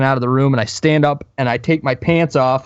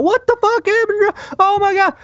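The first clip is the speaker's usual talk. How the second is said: hammed-up freak-out, pitched way up; wife freaking out. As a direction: imitate a panicking wife in squealing falsetto. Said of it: "my wife starts freaking out".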